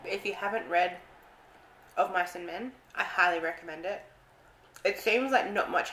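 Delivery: eating berries between words; chewing while talking. Real, not acted. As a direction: mouth partly full. Seen 0: Puts berries in mouth, chews between words, hand at lips.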